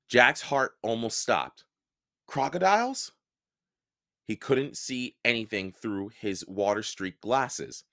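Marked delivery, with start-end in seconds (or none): 2.3-3.1 frightened. Acted. Jack fearing crocodiles.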